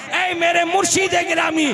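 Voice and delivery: loud, high devoted cry to the master; devoted disciple. Retold or imitated. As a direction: call out to the master with love. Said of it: "मोहब्बत का इजहार करके कहते हैं".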